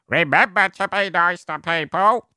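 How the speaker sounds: put-on high, rambling, doddering old man; old shambling man. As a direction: play a lost old man preaching kindness. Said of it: "just an old shambling man just going round going".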